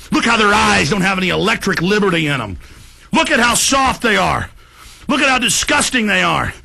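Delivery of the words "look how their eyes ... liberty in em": usual speaking voice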